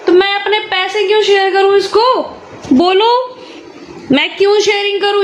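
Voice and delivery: playful indignation. Real, not acted. Mother playfully scolding son.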